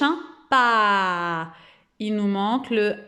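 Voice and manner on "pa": "Pa" with a long open a; wide-open mouth. Shown as a demonstration. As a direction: drop the jaw, stretch the a in "Pa". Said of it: "on ouvre grand la bouche". (« Pa » bouche grande ouverte)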